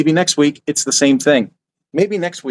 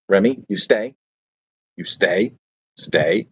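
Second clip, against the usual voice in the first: dog command; 4 semitones lower, slower than usual. Real, not acted. Commanding his dog to stay.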